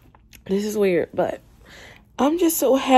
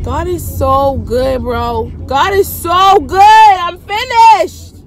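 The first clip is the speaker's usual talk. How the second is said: louder joyful thanks to God; praise; 6 semitones higher, 9 dB louder, slower than usual. Real, not acted. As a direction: testify it.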